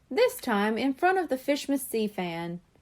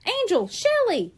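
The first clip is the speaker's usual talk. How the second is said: higher, calling names sharply; shouting; 9 semitones higher, 3 dB louder than usual. A narrator's reading. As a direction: call out to wake them. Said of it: "she shouted".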